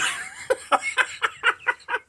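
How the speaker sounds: high, breathy cackle; real laugh. Real, not acted. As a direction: crack up at your own impression. Cracks up at his own imitation.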